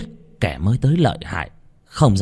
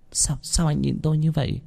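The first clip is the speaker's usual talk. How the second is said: put-on higher, softer bashful young woman; shy flustered girl; 6 semitones higher, 4 dB quieter than usual. A narrator's reading. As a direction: stammer it out shyly. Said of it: "có chút lúng túng thẹn thùng nói".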